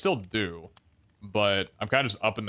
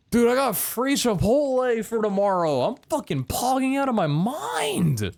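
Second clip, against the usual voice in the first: high, drawn-out thrilled voice; excited; 13 semitones higher than usual. Real, not acted. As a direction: gush with over-the-top glee. Thrilled about free Chipotle.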